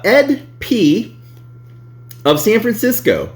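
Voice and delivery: slow, high announcer read. Acted. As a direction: introduce the reviewer like a show host. Announcing reviewer's name.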